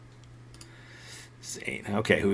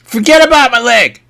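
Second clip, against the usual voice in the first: angry shout; 9 semitones higher, 6 dB louder than usual. Acted. Kid snaps at friend.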